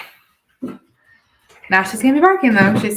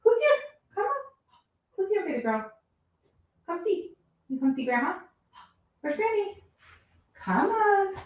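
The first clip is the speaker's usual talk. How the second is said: calling a dog; 13 semitones higher than usual. Real, not acted. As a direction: coo at the puppy to come. Coaxing dog inside.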